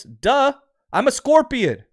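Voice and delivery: high-pitched, incredulous "duh" delivery; mock-obvious punchline. Scorpion answering the drowning frog, mock-obvious.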